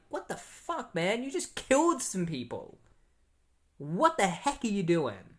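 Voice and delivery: high-pitched disbelieving outburst; mock outrage. Imagined outraged reaction to killings.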